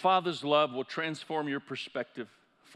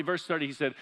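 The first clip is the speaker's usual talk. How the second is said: ordinary talk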